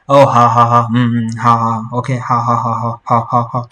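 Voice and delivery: mock Chinese caller reluctant to hang up; lingering hao, hao fading away; imitating hanging-up hao. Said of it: "they'll be like".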